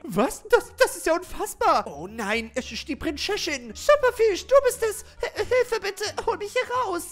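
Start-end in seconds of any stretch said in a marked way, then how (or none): 3.7-7.1 much higher, louder cry for help; pleading princess. Acted. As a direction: plead to be rescued. Gefangene Prinzessin ruft um Hilfe. (hohe, flehende Prinzessinnenstimme)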